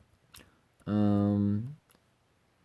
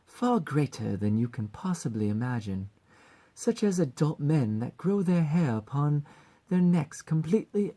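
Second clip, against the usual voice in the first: put-on higher, theatrical British accent; 5 semitones higher than usual. Succubus mascot on a world full of terrors, high British accent. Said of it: "with a slight British accent".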